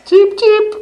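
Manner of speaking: very high tiny chick peep; peeping baby chick. Pretend. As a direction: squeak it like a little chick. Parent voicing chick again.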